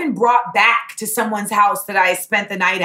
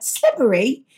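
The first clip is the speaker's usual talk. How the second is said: "Slippery," in a sweet Cockney lilt, rising at the end. Retold or imitated. Imitating her own slip into Cockney on "Slippery".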